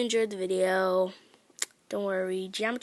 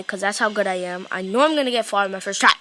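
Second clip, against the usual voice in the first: normal talking voice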